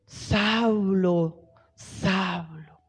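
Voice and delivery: soft, low, distant calling voice; hushed heavenly voice. Acted. Preacher makes the congregation imagine the voice calling Saulo, hushed. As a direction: call the name softly as if from far away. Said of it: "imagínense esta voz".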